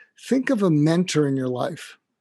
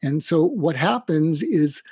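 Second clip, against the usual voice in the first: ordinary talk, nothing marked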